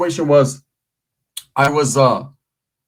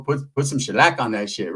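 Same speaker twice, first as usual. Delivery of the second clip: normal talking voice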